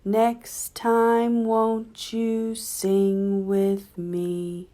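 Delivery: normal talking voice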